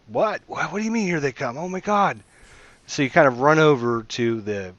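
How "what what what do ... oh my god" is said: high, alarmed, jumpy questions; startled disbelief. Retold or imitated. Defenders jolted awake by the guard's warning, high-pitched alarm. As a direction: panic at the news like a woken defender. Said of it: "everybody's like".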